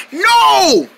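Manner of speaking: high, drawn-out cartoon no; pretend dismay. Character seeing the guitar taken, high wailed no. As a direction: wail the word in mock horror.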